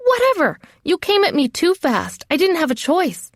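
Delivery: high, exasperated comeback; defensive retort. Acted. Trainee blaming her sparring partner, defensive retort. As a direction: snap back high, defending yourself. Arguing back at her accuser.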